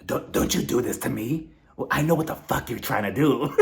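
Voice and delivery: imitated suspicious warning; mock-menacing, halting accusation; Denise. Denise warning Dorit off, mock suspicion. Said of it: "she's looking at her like".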